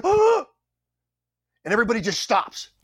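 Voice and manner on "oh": sharp, high, loud startled oh; the whole crew catching its breath at once; gasp of shock. Retold or imitated. Crew watching the captain fall through the railing, loud shocked gasp. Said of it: "everyone's like".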